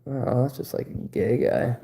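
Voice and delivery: ordinary delivery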